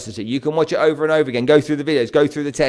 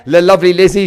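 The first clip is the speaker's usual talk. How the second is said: ordinary talk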